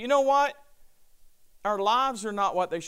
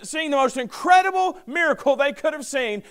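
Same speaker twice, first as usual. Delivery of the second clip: emphatic preaching; 7 semitones higher, 4 dB louder than usual. Real, not acted.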